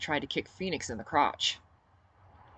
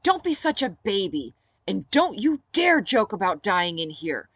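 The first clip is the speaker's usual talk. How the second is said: louder, higher scolding, stern and clipped; a touch of furious Olivia; suddenly furious; 5 semitones higher, 8 dB louder than usual. Acted. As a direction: tell him off hard, no joking. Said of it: "Olivia snarled quietly, suddenly furious".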